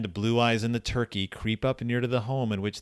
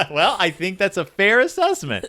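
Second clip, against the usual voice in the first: laughing; 8 semitones higher, 5 dB louder than usual. Real, not acted. Laughs while speaking.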